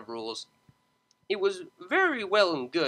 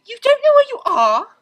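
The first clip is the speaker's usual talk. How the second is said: high, loud shocked cry; alarmed exclamation; 12 dB louder than usual. Acted. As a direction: blurt it out in alarm. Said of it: "exclaimed Octavia".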